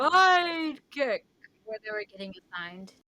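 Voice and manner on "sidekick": loud, high, drawn-out shout; mock dramatic yell; Sky High imitation. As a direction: bellow it like a movie catchphrase. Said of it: "Sky High".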